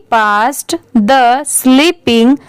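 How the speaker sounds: ordinary talk, nothing marked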